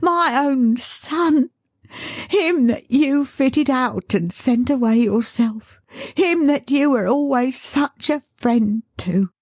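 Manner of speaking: exultant. Acted. Caleb showing his son to the carrier, exultant. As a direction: crow with joy. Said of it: "said Caleb exultingly".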